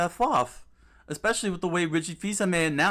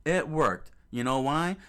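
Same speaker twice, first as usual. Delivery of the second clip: ordinary talk, nothing marked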